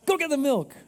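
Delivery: high, playful rallying order; rally him up, high and playful; rallying wife. Pretend. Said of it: "your husband to take out the trash".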